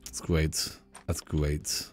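very low, hushed remark; muted aside. Real, not acted. Player reacting after the goodbye, very quiet aside. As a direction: drop to a quiet, low murmur.

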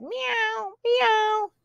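soft, high mews; meowing cat. A narrator's reading. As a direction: mew softly like a kitten. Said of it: "The cat said".

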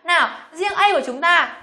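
ordinary talk

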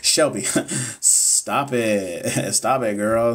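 real bashful laughter; laughing. Real, not acted. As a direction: giggle it off, flattered. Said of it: "laugh cry".

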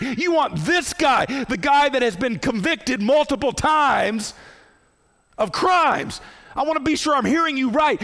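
dumbfounded Pilate. Acted. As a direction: plead with the crowd in disbelief, voice climbing. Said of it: "He's saying".

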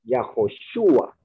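slow "Yahoshua" with the weight on the U, yaho-SHU-a; slow, careful demo of "Yahoshua" stressing the U. Shown as a demonstration. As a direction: spell out "Yahoshua" slowly, leaning on the U. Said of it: "la forma más fuerte de entonación es en la U".

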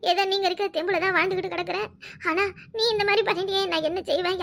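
normal talking voice

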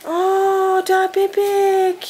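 delighted sing-song for the little goat. Real, not acted. Excited at newborn baby goat.

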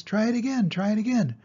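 high goose cry; the goose urging Wilbur to try again, high. A narrator's reading. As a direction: egg him on, honking. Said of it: "cried the goose".

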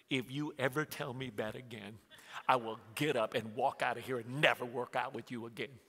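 low, soft, controlled threat; stern warning. Retold or imitated. Husband warns wife in the gym, low and stern. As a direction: imitate a husband warning with quiet menace.

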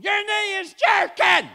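loud, high, slow yell; wife's shout. Retold or imitated. Wife of forty-three years hollering at husband, loud and high. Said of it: "She's going".

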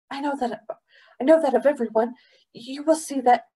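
put-on high, eager, stumbling boy; breathless boy. A narrator's reading. Boy flatters the governor, breathless and eager. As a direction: rush to win over someone revered. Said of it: "said the boy breathlessly".

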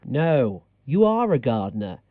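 ordinary delivery

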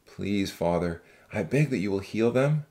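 deep, measured male voice praying; low, reverent man's voice; earnest prayer. Acted. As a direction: plead sincerely with God, pitched very low. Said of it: "Zach prayed earnestly".

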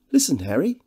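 high, loud, gushing society man; jovial host. Acted. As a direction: introduce your old friend with great fuss. Devine's lines in the novel.

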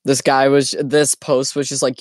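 ordinary talk, nothing marked

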